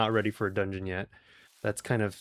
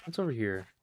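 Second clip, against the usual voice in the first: usual speaking voice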